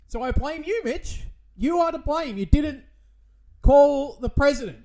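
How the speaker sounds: mock blaming shout. Blaming Mitch, rising shout.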